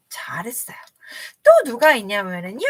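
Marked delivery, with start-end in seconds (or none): 0.1-0.8 soft whisper of 잘했어요; hushed good job; whispered praise. Acted. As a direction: breathe the praise warmly. Praising class softly.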